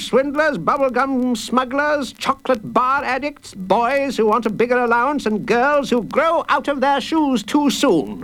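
high, indignant courtroom rant; pompous judge. Acted. Busy judge complaining about rude children, blustering high. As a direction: rattle off offenders with pompous disgust.

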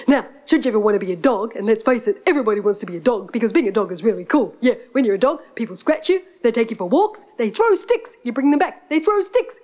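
fast, panting dog voice; rushed words with panting laughs; eager, friendly dog. Acted. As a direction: chatter like an excited dog.

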